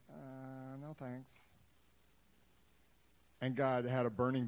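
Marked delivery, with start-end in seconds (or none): 0.1-1.3 soft, unwilling brush-off; reluctant Moses. Acted. Moses quietly turning down the job. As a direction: shrug off God's offer. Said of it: "And Moses is like".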